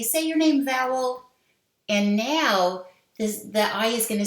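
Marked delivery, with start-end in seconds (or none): 0.0-1.3 magic E voice. Pretend. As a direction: call out like the E giving an order. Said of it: "the E is gonna go over to the I and say".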